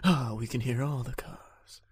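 slow whispered supervillain; slow theatrical villain menace. Retold or imitated. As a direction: hiss it like a scheming villain. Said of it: "sound like a supervillain".

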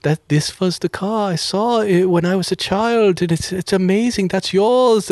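put-on amazed, as if seeing a childhood car; lightly voiced German man. German man moved by the old police Beetle, lightly accented. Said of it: "He's like".

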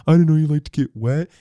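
high, loud teasing voice; mock silly taunt; giggling friend. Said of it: "Ryan just starts laughing, giggling".

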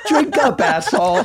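mocking toast. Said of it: "And she's like".

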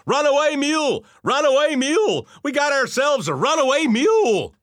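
high, loud frantic holler; shrill alarm call to a sleepy audience. Acted. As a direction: yell it like a panicked man bursting into a theater. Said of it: "he entered the theater and hollered".

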